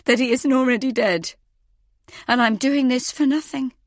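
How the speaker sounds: high, frightened questioning; nervous, quivering demand for proof. A narrator's reading. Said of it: "my voice quivering".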